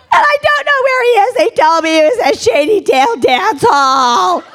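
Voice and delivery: high wailed mock sobs; comic crying, starting very high; bawling. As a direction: wail through exaggerated tears. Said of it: "I start bawling and crying".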